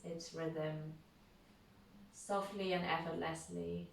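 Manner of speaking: unhurried, calm yoga nidra voice with long pauses; guided meditation. Real, not acted. Leads a guided relaxation.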